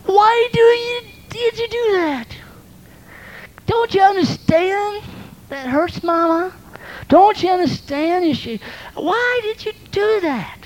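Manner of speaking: high, shrill-edged scolding mother; a mother upset at her child. Acted. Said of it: "And you go".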